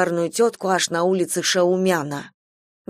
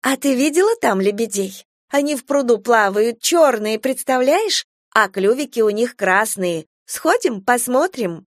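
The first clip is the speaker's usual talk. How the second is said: high sing-song coaxing; coaxing a child; 5 semitones higher than usual. Acted. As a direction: lure the child sweetly. Stranger lures child with swans.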